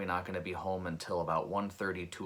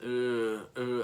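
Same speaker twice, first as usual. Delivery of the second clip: higher, louder pained grunt; imitated grunt; 4 semitones higher, 4 dB louder than usual. Injured rider on the ground, imitated weak grunt. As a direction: imitate a dazed man grunting. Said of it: "all he was able to do was grunt".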